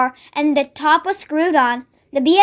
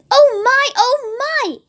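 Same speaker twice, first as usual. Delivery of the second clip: delighted gasp; 9 semitones higher than usual. A narrator's reading. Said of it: "he said".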